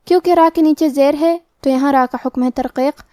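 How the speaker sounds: normal talking voice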